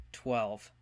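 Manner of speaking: ordinary talk, nothing marked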